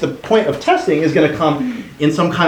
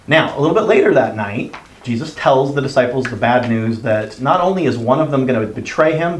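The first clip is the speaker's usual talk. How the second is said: usual speaking voice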